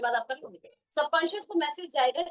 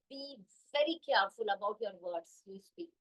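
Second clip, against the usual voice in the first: slow emphatic; 2 semitones lower, 6 dB quieter, much slower than usual. Shown as a demonstration. Emphatic advice to the audience.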